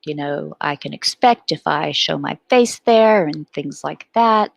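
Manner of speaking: ordinary talk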